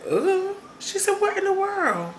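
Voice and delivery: high strained imitation of the sister; imitating a sister hurting; pained sister. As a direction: groan it out like someone in pain. Sister in pain arriving at the door.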